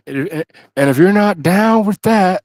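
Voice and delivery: wrestling catchphrase. Retold or imitated. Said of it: "continuing the DX catchphrase".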